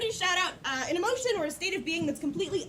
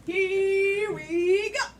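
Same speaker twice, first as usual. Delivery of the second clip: very slow, higher sing-song launch; drawn-out kickoff; 4 semitones higher, 4 dB louder, much slower than usual. Real, not acted. Host starting the next chapter, drawn-out call. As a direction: stretch each word to build anticipation.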